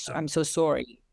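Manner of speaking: imitated Fargo-style Midwestern accent. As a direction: put on a light Fargo accent, rounding the O in sorry. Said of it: "more like Fargo".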